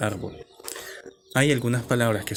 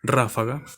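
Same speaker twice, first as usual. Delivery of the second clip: "Ráfaga" with RÁ punched, the rest lighter; stress on first syllable. Shown as a demonstration. Teacher presenting an example word, stress on initial RÁ. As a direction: hit RÁ in "Ráfaga", then drop off. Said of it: "lleva su mayor fuerza de voz en la antepenúltima sílaba".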